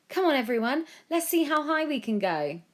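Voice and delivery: rally everyone with glee; excited boy. A narrator's reading. Joe cheering everyone on bouncing.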